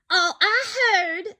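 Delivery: a hint of a snappish kangaroo; snapped. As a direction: bite the words off. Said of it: "snapped the big kangaroo".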